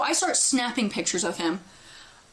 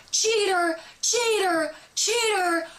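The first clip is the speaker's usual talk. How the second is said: retold scream; 7 semitones higher, slower than usual. Storyteller re-enacts yelling at her friend's cheating boyfriend, high mock scream. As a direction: imitate yourself screaming an accusation, pitched way up. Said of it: "I start pointing and screaming".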